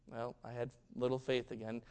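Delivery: soft, low self-reproach; discouraged thought. Retold or imitated. Peter thinking as Jesus chides, quietly discouraged. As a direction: admit it quietly to yourself.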